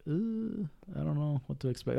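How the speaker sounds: mild mock apprehension, kept soft; wary hesitation. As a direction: lightly mimic a newcomer's quiet unease. Voicing a wary newcomer to Compton.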